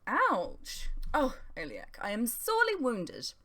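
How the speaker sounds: Talgoth playing hurt, high and theatrical; hammed-up injured pride; mock wounded. A narrator's reading. Talgoth teasing Eliak, mock wounded. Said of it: "slumped back in his seat".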